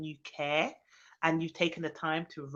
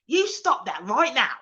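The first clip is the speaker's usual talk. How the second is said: imitated stern Essex mum, louder and higher; mum's telling-off; 5 semitones higher, 7 dB louder, slower than usual. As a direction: scold slowly like a no-nonsense mother. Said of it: "just the voice, like".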